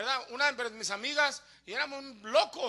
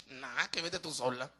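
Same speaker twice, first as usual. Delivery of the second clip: low, soft brush-off; uninterested low grumble; dismissive refusal; 12 semitones lower, 9 dB quieter than usual. Acted. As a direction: imitate a relative waving off the invitation. Acts out relative's dismissive refusal.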